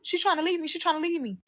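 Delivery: imitated crying, pitched high; high-pitched mock-tearful complaint; tearful pleading. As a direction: mimic him wailing to his mother. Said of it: "He's crying".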